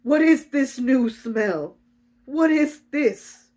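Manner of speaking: slow, loud, high questioning; hammed-up urgent demand; distressed demand. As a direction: press each word in bewilderment. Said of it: "goes into her room distressed".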